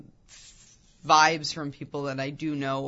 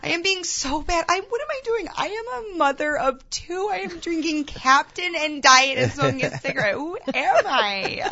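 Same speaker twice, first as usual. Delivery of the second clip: high, squealing tipsy mom voice; giddy new mom; 11 semitones higher, 4 dB louder than usual. Acted. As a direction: gush guiltily like a mom on a night out. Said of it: "going, like".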